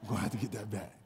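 very low, quiet superhero reply; Batman voice. Retold or imitated. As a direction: answer deep, hushed and deadpan. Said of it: "He's like".

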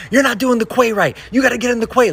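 high-pitched mock yell; yelling lady. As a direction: imitate a woman telling someone off, high and insistent. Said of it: "there's a lady yelling".

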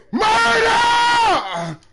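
high, long shouted cry; hollering murder after a big play; victory yell. Real, not acted. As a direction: belt it out like a war cry. Celebrating a play, yelling.

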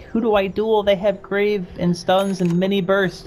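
high, slow, cowering plea; scared voice. Retold or imitated. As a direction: shrink back and ask who to fight. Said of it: "I like your scared voice".

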